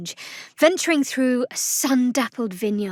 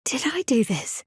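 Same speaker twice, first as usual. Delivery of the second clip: hushed unvoiced whisper; whispered uneasy question; 2 semitones higher than usual. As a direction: ask yourself under your breath. Unsettled realization to herself.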